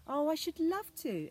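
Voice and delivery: put-on high, loud, eager little girl; delighted exclaim. Anna answering the fairies, high and thrilled. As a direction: burst out with delight at the invitation. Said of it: "exclaimed Anna".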